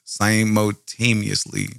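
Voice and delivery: slowly sounding out Simultaneously syllable by syllable; slow careful pronunciation. Shown as a demonstration. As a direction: spell out Simultaneously slowly, one part at a time. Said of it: "simultaneously stopped".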